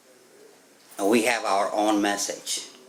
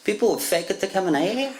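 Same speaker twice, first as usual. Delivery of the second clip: quick, higher, disbelieving; incredulous; 6 semitones higher, faster than usual. Retold or imitated. Mocking his own disbelief at the book, fast and high. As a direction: scoff at the idea, half laughing. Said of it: "I'm like".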